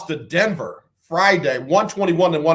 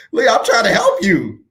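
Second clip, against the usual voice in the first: laughing; 6 semitones higher than usual. Real, not acted. Laughing while pleading.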